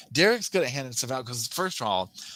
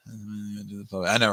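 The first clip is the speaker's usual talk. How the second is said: usual speaking voice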